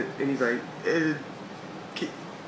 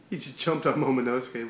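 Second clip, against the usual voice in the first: ordinary talk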